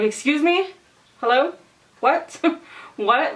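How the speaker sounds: high, rising questions in mock outrage; incredulous questioning. Client questioning the hairdresser about the water, incredulous. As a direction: demand an answer in acted-out disbelief.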